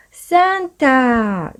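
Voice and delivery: high, bright shout of the name; cheering. A narrator's reading. Little George cheering at Santa's letter, high and excited. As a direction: call it out with delight. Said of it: "cheered George".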